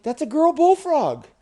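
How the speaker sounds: high, loud exclamation; excited bullfrog. Acted. Frog pointing out a girl bullfrog, excited. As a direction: announce an amazing sighting.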